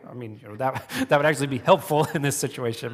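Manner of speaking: ordinary delivery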